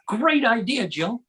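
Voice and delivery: high, delighted group praise; hammed-up "what a brilliant idea"; acted enthusiastic approval. Everybody at the table praising Joe's idea, mock excited. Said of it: "And everybody said".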